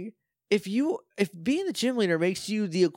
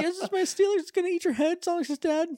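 put-on very high girlish voice, laughing; timid girl voice; 10 semitones higher than usual. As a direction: squeak it like a giggly shy girl. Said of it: "She's like".

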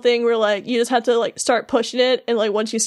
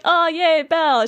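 higher, playful mock cheer; mildly excited greeting; 6 semitones higher than usual. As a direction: imitate a group's happy hello. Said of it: "excited to see Sora and Goofy".

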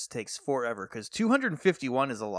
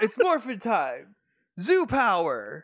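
gung ho; 6 semitones higher, slower than usual. Acted. Hero transformation call.

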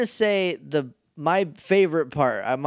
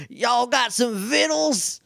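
slow, high-pitched, hammed-up Southern drawl; thick Southern accent; 7 semitones higher, slower than usual. Monster barging in on the villains, thick Southern accent. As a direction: ham up a thick Southern twang, stretching the words. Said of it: "a very thick Southern accent".

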